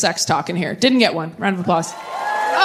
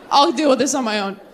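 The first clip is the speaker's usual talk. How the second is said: ordinary talk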